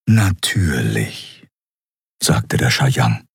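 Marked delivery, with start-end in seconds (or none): 0.1-1.5 very slow, measured mentor voice; gelassener Mentor. Acted. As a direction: stretch the word out calmly. Mentor figure answers her. (langsame, bedächtige Mentorenstimme)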